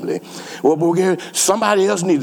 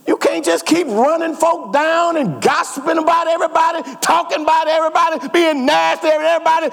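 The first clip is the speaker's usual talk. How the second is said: preaching at full force; 9 semitones higher than usual. Acted. Preacher calling out his church for gossiping, in full preaching cry. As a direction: raise the voice and drive it home to the congregation.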